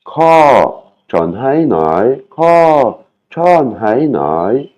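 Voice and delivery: ko pitched high, chon hai nai drawn out slowly; each syllable sung out slowly for learners; slow Thai phrase. Shown as a demonstration. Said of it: "On va l'écouter dans Google".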